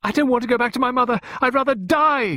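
fast, high-pitched hysterical outburst; screaming. A narrator's reading. Pippa refusing to return to her mother, screaming. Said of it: "she screamed".

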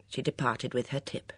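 ordinary delivery, nothing marked

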